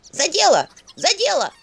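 high, loud jerked outburst; sudden shriek. A narrator's reading. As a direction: jolt out a shrill cry. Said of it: "Внезапно дёрнулась икотница".

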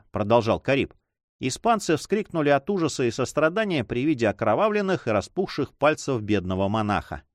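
usual speaking voice

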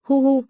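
mock-tragic blubbering; sobbing. A narrator's reading. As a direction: break into a wailing sob. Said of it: "vừa khóc vừa lẩm bẩm".

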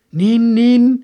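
high, loud herald voice; strange soldier. A narrator's reading. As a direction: deliver tidings like an old-time soldier.

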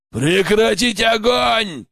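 slow, high shouted command; barked order. A narrator's reading. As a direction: bark the order at the camp. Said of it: "гаркнул медведь".

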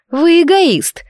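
high, sharp accusation; indignant outburst. Acted. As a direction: fling the accusation at him. Heroine accuses emperor of selfishness.

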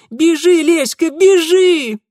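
high, loud cry to run; urgent shout. Acted. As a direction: scream at her to flee. Urging friend to flee. (крик «спасайся»)

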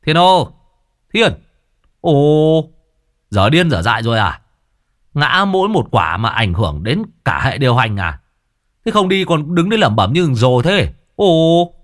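loud, high calls across the yard; yelling from the gate. Acted. Old Thưởng Báo hollering at Thiên from the gate, loud yell. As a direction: bellow his name from outside.

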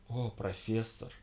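slow, breathy, lustful moan; sensual moan. Acted. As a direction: moan it out slowly, yearning. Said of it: "сладострастно простонала Джейн Блензи". (сладострастный стон)

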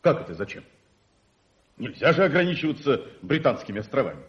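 put-on outraged old colonel sputtering, then rushing on; can't believe what he just heard; indignant surprise. Colonel shocked by young socialist.

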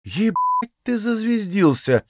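high, slow mock amazement; drawn-out, exaggerated fake wonder; feigned surprise. A narrator's reading. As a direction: act astonished with stretched words. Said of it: "изобразила она удивление".

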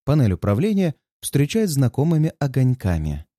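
ordinary delivery

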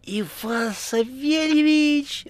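high, drawn-out delighted greeting; joyful cry. A narrator's reading. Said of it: "радостно вскричала трубка". (радостный вскрик высоким голосом)

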